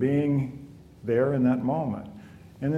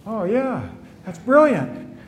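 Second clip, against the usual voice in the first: much higher, laughing "brilliant" as a mock-dazzled student; mock amazement; 10 semitones higher, 6 dB louder, slower than usual. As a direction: gush over the answer with a laugh. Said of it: "and they would go".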